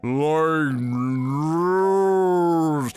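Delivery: doped-up slow commentator impression; slow drugged drawl. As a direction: drag every word like you're sedated. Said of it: "he sounds like he's off a perk".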